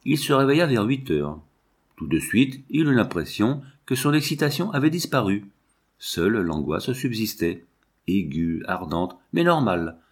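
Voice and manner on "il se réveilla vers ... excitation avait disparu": usual speaking voice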